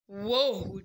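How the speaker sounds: ordinary talk, nothing marked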